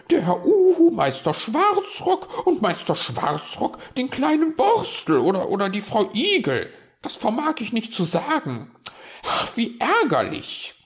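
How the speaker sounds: very high, loud squawking rant; indignant magpie. Acted. Magpie thrown out by everyone, ranting indignantly. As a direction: squawk out your outrage to nobody.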